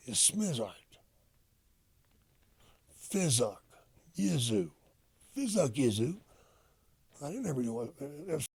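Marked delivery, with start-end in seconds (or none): 0.1-0.7 "Smizart" with "iz" slipped in after the "Sm"; Carney slang. Shown as a demonstration. Explaining Carney talk, saying "Smizart" with "iz" inserted. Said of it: "like a smart, is Smizart". 3.1-6.2 "Fizuk Yizu" as fuck you with "iz" after each first consonant; Carney slang. Shown as a demonstration. Demonstrating Carney on a swear, "Fizuk Yizu" with "iz" inserted. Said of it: "you put it after the first consonant".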